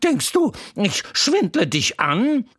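put-on a chatty horse teasing the crying boy; Troubadour the talking horse. A narrator's reading. Horse Troubadour comforting the crying boy Wendel, high and loud.

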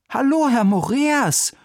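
put-on high, bright call of a boy; boy's greeting. A narrator's reading. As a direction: greet the visitor like an eager boy. Boy greets visitor at door. (hoher Jungenruf zur Begrüßung)

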